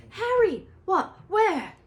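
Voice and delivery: high loud startled shouts; excited shouts. A narrator's reading. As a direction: call out, looking around. Excited match moment.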